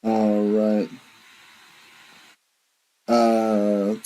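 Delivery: usual speaking voice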